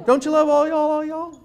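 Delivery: high, loud "all y'all" sung out with relish; gushing delight. Shown as a demonstration. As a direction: show off "all y'all" like a prize. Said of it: "Best word ever".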